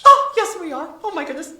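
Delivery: excited. Real, not acted. Guest excited about new house.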